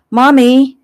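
put-on high, loud child's call for mom; little girl crying out. Heather calls her mother from the kitchen, a high loud child's cry. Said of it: "Heather cried".

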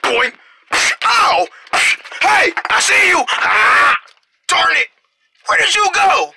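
high, exclaimed chase lines; punchy cartoon outbursts in play; excited play-acting. Startled character spots an intruder, excited play-acting.

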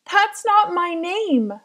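slow, loud cry of "That's not my name!"; a child finally yelling her protest; shout. Acted. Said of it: "She wanted to shout".

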